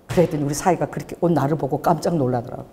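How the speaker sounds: ordinary talk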